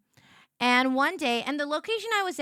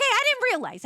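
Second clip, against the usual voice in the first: high defensive; 11 semitones higher, 3 dB louder than usual. Real, not acted. Mock defense.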